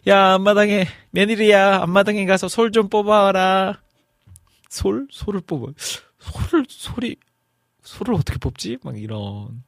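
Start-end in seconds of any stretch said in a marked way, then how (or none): 0.1-3.8 higher, louder bossy mother-in-law call; mother-in-law ordering. Retold or imitated. Mother-in-law calling her daughter-in-law to the yard, bossy higher call. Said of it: "시어머님이 솔 뽑아오라고 하셔서". 4.7-8.8 higher, puzzled mumbling to herself; baffled bride. Retold or imitated. New daughter-in-law puzzling over the order, mildly baffled.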